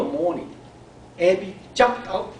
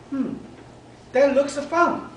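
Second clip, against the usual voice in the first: put-on little girl's voice; 10 semitones higher, 3 dB louder than usual. Girl watching snail in bubbles.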